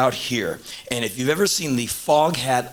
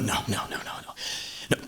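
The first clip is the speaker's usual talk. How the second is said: fading refusal; 5 semitones lower, 10 dB quieter, much faster than usual. Retold or imitated. Losing nerve before a dance.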